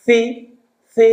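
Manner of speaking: tongue tip at teeth on "সি"; thin THI. Shown as a demonstration. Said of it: "সা জেড". (পাতলা "সি")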